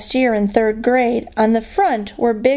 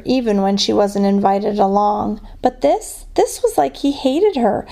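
usual speaking voice

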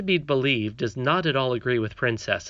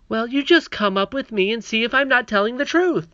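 little princess voice; 10 semitones higher, 6 dB louder than usual. Acted. Princess pleading with nurse.

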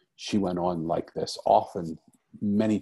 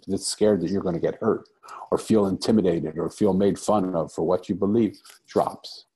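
usual speaking voice

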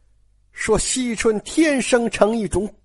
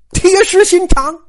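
scolding outburst; 9 semitones higher, 6 dB louder than usual. Acted. Sister-in-law scolding stubborn girl.

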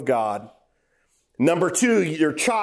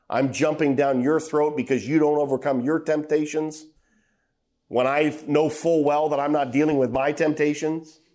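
ordinary delivery

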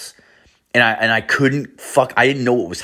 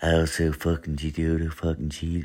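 mumbling; 4 semitones lower, 9 dB quieter than usual. Retold or imitated. Unseen guy talking nonsense outside the shower, low soft mumble. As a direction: imitate a guy mumbling under his breath. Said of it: "this guy mumbling shit".

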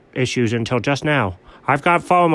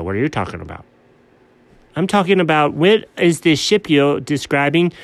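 usual speaking voice

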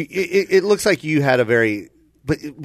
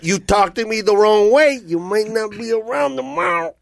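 mock mobster threat, higher pitched; bad Joe Pesci; 4 semitones higher than usual. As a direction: threaten like a badly faked gangster. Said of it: "like a really bad Joe Pesci".

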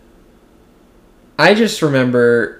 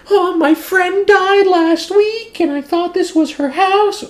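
high, slow, tearful voice, well above own pitch; crying woman; slower than usual. Retold or imitated. Grieving stranger explaining to the homeowner, high and tearful. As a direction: imitate the grieving woman explaining through tears. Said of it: "literally, like, crying".

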